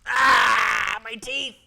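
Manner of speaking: high, very loud play-acted howl; mock pain scream. Player losing teeth in the game, mock scream of pain. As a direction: scream in hammed-up agony.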